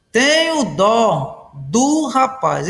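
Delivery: slow, measured word-by-word reading; slow example reading. Shown as a demonstration. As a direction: spell the sentence out slowly for students. Teacher repeats example sentence slowly. (frase de exemplo lida devagar)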